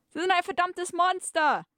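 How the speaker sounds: high, melodramatic indignation; outraged outcry. Acted. Captive accusing the villain, high hammed-up outcry.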